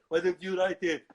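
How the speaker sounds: loud, thick Austrian action star; aggrieved muscleman impression; Arnold Schwarzenegger. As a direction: throw it back in a heavy Austrian accent. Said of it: "then Schwarzenegger pops up".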